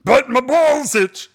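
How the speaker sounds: high, loud, drawn-out mock complaint; hammed-up comic outcry; skit character. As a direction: wail it slowly like a silly skit line. Quotes a line from the album skit.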